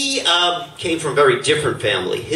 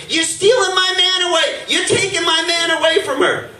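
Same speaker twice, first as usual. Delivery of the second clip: jealous shout. Retold or imitated. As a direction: shout her down for stealing him. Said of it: "And she goes".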